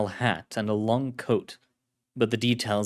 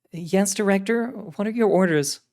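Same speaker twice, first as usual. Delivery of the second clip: female agent voice; 10 semitones higher, 3 dB louder than usual. Acted. Carlotta voiced.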